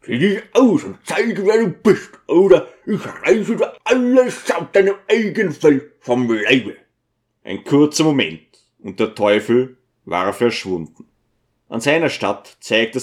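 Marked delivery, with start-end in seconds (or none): 0.0-6.8 sharp order, pitched higher; commanding. Acted. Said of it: "kommandierte er".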